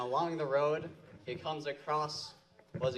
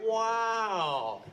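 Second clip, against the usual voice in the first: loud, high wow; excited wow; 8 semitones higher, 7 dB louder than usual. Acted. Frog marvels at the goat, shouting high. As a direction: burst out amazed.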